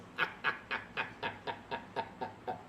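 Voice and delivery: long, soft, voiceless cackle; mocking laughter. Real, not acted. Streamer laughing at crying over a console, mocking laughter. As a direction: keep chuckling in a long run.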